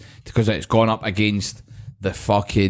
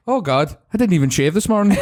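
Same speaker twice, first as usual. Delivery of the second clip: quoting Dougal; 9 semitones higher than usual. Retold or imitated. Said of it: "He goes".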